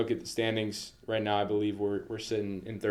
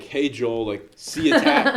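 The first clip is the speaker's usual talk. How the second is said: hammed-up polite email read; playfully imitating a stiff email; mock formal email voice; 6 semitones higher, 10 dB louder, slower than usual. Mocking a recruiting email.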